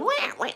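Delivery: high, thin mock gull squawk; squawking seagull cry. Telling the radio hosts how the gulls squawked, mock high squawk.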